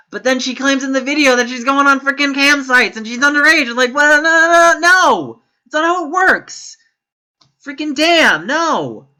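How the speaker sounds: high, loud, incredulous rant; worked-up, exasperated protest; exasperated outburst. Real, not acted. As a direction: build to a high, loud string of no's. Incredulous rant at her claim.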